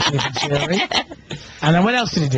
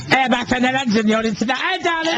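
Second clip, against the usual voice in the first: higher mock London chatter; 7 semitones higher than usual. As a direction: send up her chatty stage voice. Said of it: "What is that voice?".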